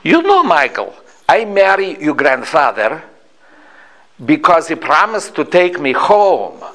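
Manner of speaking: imitated Slavic-accented grandmother; thick Slavic accent. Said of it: "in her thick Slavic accent".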